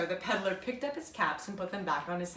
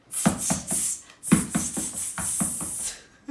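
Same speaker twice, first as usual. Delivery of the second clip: loud unvoiced tsk sounds; monkey tsk; 3 dB louder, slower than usual. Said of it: "all the monkeys".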